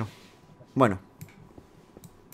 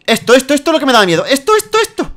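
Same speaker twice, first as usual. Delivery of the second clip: scared; 10 semitones higher, 4 dB louder, faster than usual. Real, not acted. Lava fear, fast and high.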